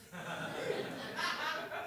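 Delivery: a bright burst of laughter; high laugh. Real, not acted. Storyteller laughing at escaping Manson's knife. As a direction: laugh out at the memory.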